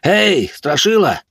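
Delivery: high, slow jeering call; mocking shout. Drunk youths hail the man from behind, jeering shout. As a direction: call out tauntingly at someone's back. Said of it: "крикнули ему в спину".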